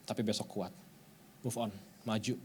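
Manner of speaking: soft, tender encouragement; gentle voice of God. Retold or imitated. God tenderly comforts a crying believer, soft and reassuring. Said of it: "kata Tuhan".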